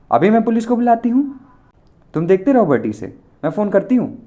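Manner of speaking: high, loud stern threat; scolding. A narrator's reading. Girl threatens to call police, loud scolding. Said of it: "लड़की ने डांटते हुए कहा".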